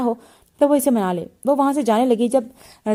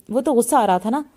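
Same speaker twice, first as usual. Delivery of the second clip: ordinary delivery, nothing marked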